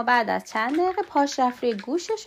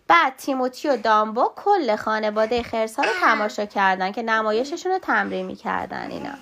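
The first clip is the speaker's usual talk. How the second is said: usual speaking voice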